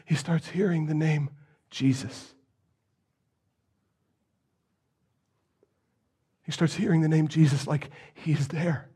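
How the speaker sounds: whispered, barely voiced; breathy hush of a rumor passing by. A narrator's reading. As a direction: lean in and whisper it like a secret. Hushed retelling of hearing the name.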